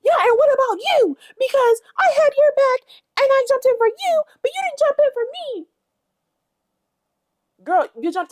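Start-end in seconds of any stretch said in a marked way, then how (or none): 0.0-5.7 very high, loud whiny imitation; mocking whiny Biggie. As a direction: whine it like a wounded brat.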